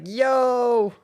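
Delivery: a loud high mock "Yo!"; imitated hype yell. Copying his own arm-waving hype moment, mock yell. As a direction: bark out "Yo!" like hyped-up past self. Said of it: "It's like".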